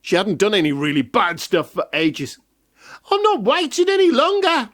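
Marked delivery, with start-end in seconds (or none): 3.1-4.7 high cackling witch voice. Acted. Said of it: "she cackled".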